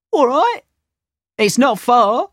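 lightly voiced helpful townsman; townsman agreeing. A narrator's reading. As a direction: agree cheerfully. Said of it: "the first man replied".

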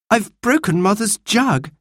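put-on high, sad child's voice; sad confession. A narrator's reading. Anthea confesses to Martha about the jug, sad. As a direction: own up sadly, pitched high. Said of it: "said Anthea sadly".

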